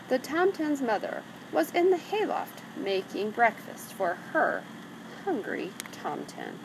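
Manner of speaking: usual speaking voice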